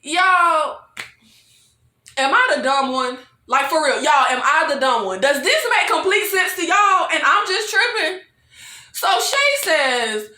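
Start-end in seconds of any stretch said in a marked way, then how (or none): none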